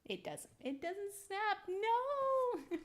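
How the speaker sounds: high-pitched frustrated cry; rising in pitch to a wailing no. Real, not acted. Part won't snap into place.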